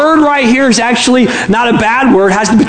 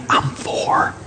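put-on very soft child's whisper; small whisper; 9 dB quieter than usual. Four-year-old answering a telemarketer, whispering. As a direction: whisper like a tiny kid. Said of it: "Still whispering, the voice said".

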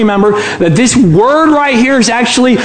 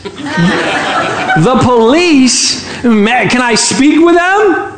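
drawn-out, higher startled question; taken aback; 4 semitones higher, much slower than usual. Acted. Telemarketer hearing police are there, taken aback. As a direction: stretch it out in astonishment.

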